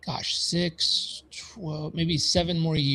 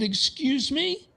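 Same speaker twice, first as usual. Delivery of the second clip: a much higher, slow, incredulous excuse me; indignant disbelief; 11 semitones higher, slower than usual. Retold or imitated. Younger self hearing the accountant's verdict, incredulous and high. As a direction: act your younger self, stunned and offended.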